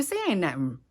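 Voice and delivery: ordinary delivery, nothing marked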